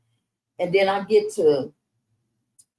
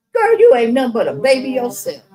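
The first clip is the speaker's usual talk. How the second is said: higher and louder, imitating Fred; loud teasing exclamation, higher; mock amused disbelief; 8 semitones higher, 8 dB louder than usual. Fred teasing her that she is a baby herself.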